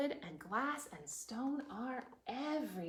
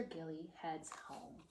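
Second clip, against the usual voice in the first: whisper; 5 semitones lower, 10 dB quieter than usual. A narrator's reading. Reader whispering as the day ends. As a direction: let it wind down to sleep. Bedtime winding down.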